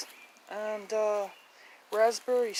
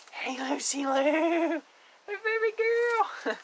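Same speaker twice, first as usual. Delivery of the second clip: very high, slow cooing to the pet; slow high pet-talk; 8 semitones higher, 4 dB louder, much slower than usual. Real, not acted.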